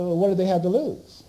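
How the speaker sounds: normal talking voice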